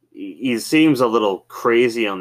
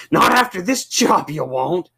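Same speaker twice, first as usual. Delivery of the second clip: much higher put-on voice; dry comeback; 10 semitones higher, 4 dB louder than usual. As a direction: toss back a mock deadpan jab. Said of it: "And he just goes".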